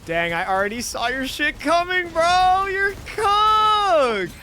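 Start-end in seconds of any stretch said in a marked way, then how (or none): none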